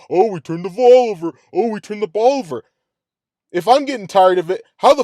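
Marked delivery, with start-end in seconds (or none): 0.1-2.6 mocking repetition. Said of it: "saying the same shit again".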